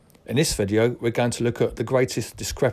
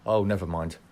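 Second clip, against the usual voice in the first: usual speaking voice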